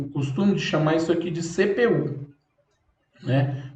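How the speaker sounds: ordinary talk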